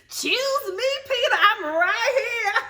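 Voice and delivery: high, hammed-up whine with laughter creeping in; imitated fan plea. Older fan begging Peter to notice her, mock pleading. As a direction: beg to be picked in mock desperation.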